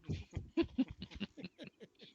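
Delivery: high, soft run of giggles. Real, not acted. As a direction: giggle quietly at your own joke. Laughs at own joke.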